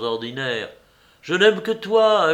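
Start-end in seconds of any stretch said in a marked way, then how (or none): 1.2-2.3 high, loud protest of love; passionate outcry. A narrator's reading. Léonie swears to Juliette he loves only her, high and loud. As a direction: cry out your devotion.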